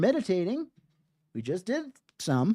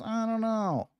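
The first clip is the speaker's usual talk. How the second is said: higher, softer doubtful boss voice; 7 semitones higher, 5 dB quieter than usual. Retold or imitated. Teacher mimicking a boss unsure about the work, higher and softer. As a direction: voice the unimpressed boss, lightly. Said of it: "our boss says".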